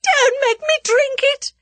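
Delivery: very high, loud plea; frantic high-pitched scream of words; terrified shriek. A narrator's reading. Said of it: "Her voice rose to a shriek".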